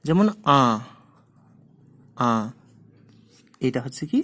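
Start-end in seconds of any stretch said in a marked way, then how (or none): 0.6-0.6 "আ" sounded through the nose; nasal vowel. Shown as a demonstration. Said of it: "সানুনাসিক স্বর কোনগুলো". 2.2-2.3 "আ" sounded through the nose; nasal vowel. Shown as a demonstration. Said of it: "নাক দিয়ে বেশি বাতাস বেরিয়ে আসে".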